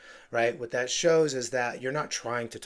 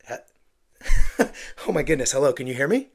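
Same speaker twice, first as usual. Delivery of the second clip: loud burst of laughter, then a flustered louder hello; clunky start with a laugh; 4 semitones higher, 12 dB louder, faster than usual. Shown as a demonstration. Agent picking up a cold call, laughing and flustered. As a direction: break into a laugh mid-greeting and recover. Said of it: "the clunky start with a laugh".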